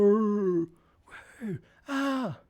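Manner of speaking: high-pitched imitated whoop; mock crowd cheer. Performer cheering their own entrance as the pretend crowd, high whoop.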